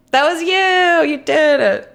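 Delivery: high, loud, drawn-out exclamation; excited recognition. Real, not acted. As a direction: call out the culprit loudly and high. Streamer credits viewer for the pun.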